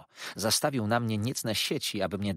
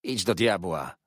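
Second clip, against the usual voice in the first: angry retort; 5 dB louder than usual. A narrator's reading. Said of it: "zawołałem".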